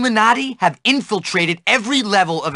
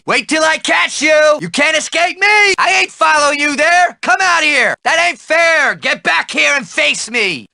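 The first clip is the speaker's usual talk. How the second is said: angry chase shouting; 5 semitones higher than usual. Acted. Angry man chasing a kid, shouting threats. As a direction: holler after the kid who got away.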